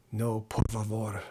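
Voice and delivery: low Spanish-accented voice; Antonio Banderas. Shown as a demonstration. Streamer trying a celebrity accent for viewers, low Spanish accent. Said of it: "an Antonio Banderas kind of accent".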